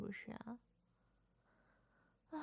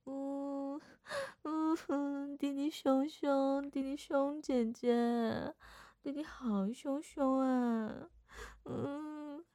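put-on slow, higher baby-talk sulking; babyish whine; 4 semitones higher, much slower than usual. Sister playing cute to scold her little brother, in baby talk. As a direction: pout it out like a spoiled little kid.

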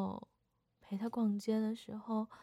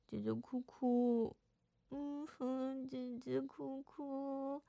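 slow, softer fake whimper in baby talk; mock crying baby talk; 3 semitones higher, 3 dB quieter, much slower than usual. Sister fake-crying at her brother in baby talk. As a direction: pretend to cry, hammed-up and cute.

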